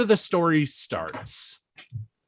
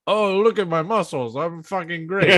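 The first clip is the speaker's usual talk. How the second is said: lightly voiced vain braggart; Gaston boast; 3 semitones higher than usual. Comparing the character to a vain Gaston, mild boast. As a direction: preen a little, half-hearted. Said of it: "like the Gaston into himself".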